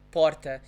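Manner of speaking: PORTA with a tapped tongue R; São Paulo R in "porta"; vibrating R. Shown as a demonstration. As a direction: say "porta" with the R flapped. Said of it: "if you'd say porta".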